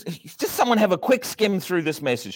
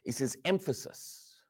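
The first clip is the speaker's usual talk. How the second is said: normal talking voice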